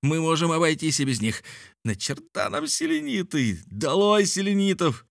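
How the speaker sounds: high-pitched rallying cry; exuberant yelling. A narrator's reading. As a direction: proclaim it high, building to a slogan. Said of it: "орал Мишель". (задорный крик)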